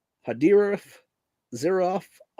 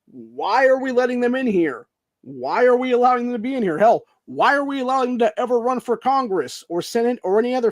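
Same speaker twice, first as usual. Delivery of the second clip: much higher and louder, hammering each why; furious shout; 9 semitones higher, 5 dB louder than usual. Real, not acted. Vlogger demanding answers from viewers, furious shouting. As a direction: yell the questions in outrage.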